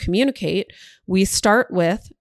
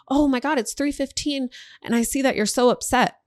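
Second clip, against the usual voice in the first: high, exaggerated mock alarm; dramatic surprise; 6 semitones higher than usual. Shown as a demonstration. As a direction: act shocked at the time, hammed up. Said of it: "That's day one".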